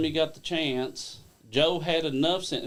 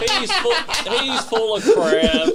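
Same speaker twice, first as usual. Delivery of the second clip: laughing disbelief; 9 semitones higher, 7 dB louder, slower than usual. Real, not acted.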